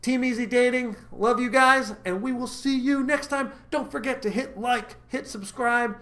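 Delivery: rally the fans to like and subscribe; cheerful host wrapping up the video; upbeat sign-off. Acted. Upbeat channel outro.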